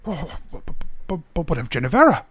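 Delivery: stuttering over a worried question; halting, taken-aback protest; flustered stammer. Acted. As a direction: stumble on the first word, then blurt it out. Flustered clansman questions her.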